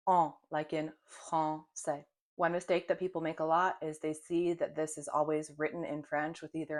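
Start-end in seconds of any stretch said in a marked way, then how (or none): 0.1-2.0 En drawn out slowly, open nasal a; slow nasal en, then français; nasal vowel en. Shown as a demonstration. French teacher models the first nasal vowel, slow. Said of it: "Our first nasal vowel is en".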